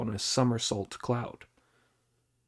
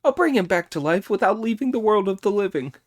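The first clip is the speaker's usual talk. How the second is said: high, fast eager voice; Monkey's promise; 9 semitones higher, 6 dB louder, much faster than usual. Acted. Monkey hurrying to promise the master, high and fast. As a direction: rush the promise out. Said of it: "Monkey's promise".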